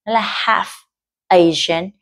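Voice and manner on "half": "half" said with no l sound; silent l. Shown as a demonstration. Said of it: "Và cái âm ờ ấy là âm câm". ("half" đọc không có âm l)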